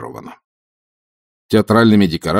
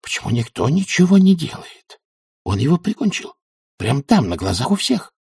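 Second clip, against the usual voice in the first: high-pitched, hissed outrage; indignant old witch, pitched up; seething indignation; 7 semitones higher than usual. A narrator's reading. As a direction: fume at what you have witnessed, keeping it down. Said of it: "просто-таки кипела и подпрыгивала на месте от ярости".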